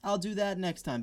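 high, mock-obliging sarcastic offer; sarcastic retort. Sarcastic retort to a complaining customer.

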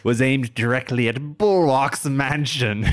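slow, loud, high alien voice; alien villain voice. Acted. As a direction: declare it like a gloating alien villain. GM continues the villain's broadcast.

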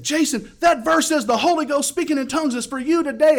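high, loud fervent proclamation; excited shouting. Retold or imitated. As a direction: shout it over pumping music. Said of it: "he got excited".

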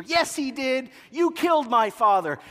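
put-on high, sulky teenage retort; like a teenager. Luke answering Vader, high teenage retort. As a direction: snap back like a teen. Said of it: "like a teenager".

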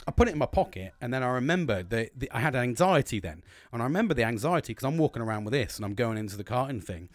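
ordinary talk, nothing marked

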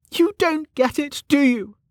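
high-pitched, irritated retort; exasperated character voice, pitched high; snapped. A narrator's reading. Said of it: "snapped Velika".

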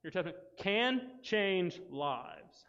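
"can change lives" drawn out slowly, word by word; emphatic repeat. Real, not acted. Teacher dictating to the group, "can change lives" slow and emphatic.

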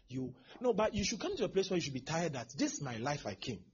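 ordinary talk, nothing marked

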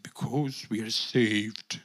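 very low, slow, drawn-out mock voice; another preacher. Speaker imitates the preacher's answer, low and ponderous. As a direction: drag out the words in a deep, solemn put-on voice. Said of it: "He said".

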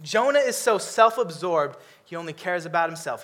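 usual speaking voice

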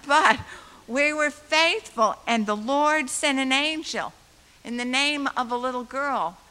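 loud, emphatic preaching voice. Real, not acted. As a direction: preach it with full force. Preaching point.

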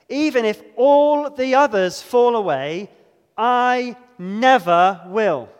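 loud, emphatic vow, last words slow; emphatic Peter. Retold or imitated. Preacher voicing Peter's boastful vow, loud and emphatic. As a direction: swear it boldly as Peter. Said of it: "He is emphatic".

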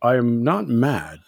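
soft, measured, halting reproach; mild disapproval. Retold or imitated. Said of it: "he was like".

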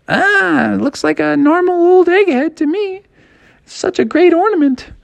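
high sister voice. Acted. Sister brushing off her brother, high voiced. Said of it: "And she's like".

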